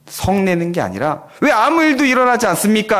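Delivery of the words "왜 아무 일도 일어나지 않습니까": higher, louder demanding complaint; 따지듯이 항의하며; complaining demand. Retold or imitated. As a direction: challenge them, demanding an answer. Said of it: "라고 따지는 게".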